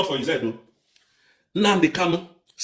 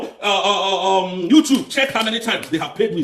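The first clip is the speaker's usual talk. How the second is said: usual speaking voice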